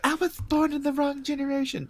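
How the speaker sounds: high, softened mock whine; mocked nostalgic whiner. Mocking everyone online who says it, small high voice. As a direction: parody it in a little high voice. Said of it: "Everyone's like".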